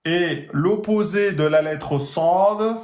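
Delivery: normal talking voice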